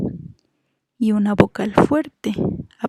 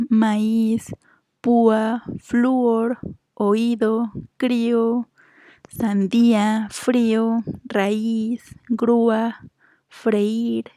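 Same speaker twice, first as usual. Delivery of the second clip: each word said apart, drawn out on its stressed vowel; measured dictation of single words with stretched vowels; word list for syllables; much slower than usual. Shown as a demonstration. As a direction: read the list slowly, lingering on every accented í and ú. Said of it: "aquí dividen en sílabas".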